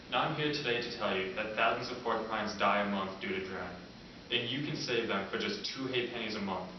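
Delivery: usual speaking voice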